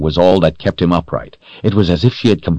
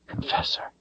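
barely voiced whisper of the word; 14 dB quieter than usual. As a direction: breathe the word out in shock. Stunned realization in narration.

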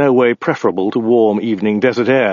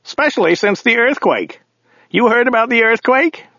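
high-pitched character voice for the driver; grumbling driver; 12 semitones higher than usual. A narrator's reading. Driver complaining to his passenger about Californians, high character voice.

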